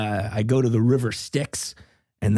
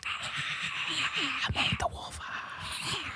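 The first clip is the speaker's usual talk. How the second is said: whisper; 13 semitones higher, 12 dB quieter, much slower than usual. Acted. Whispered wolf bit.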